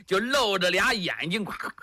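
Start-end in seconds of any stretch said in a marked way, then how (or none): 1.5-1.7 imitated hoofbeats. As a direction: run of short rhythmic clacks. Imitates the mounted troops' sound.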